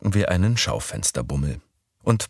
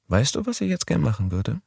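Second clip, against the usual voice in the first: put-on higher young woman's voice; Midori's voice; 9 semitones higher than usual. A narrator's reading. Narrator voicing Midori proposing Sunday, higher voice. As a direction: pitch up for the girl.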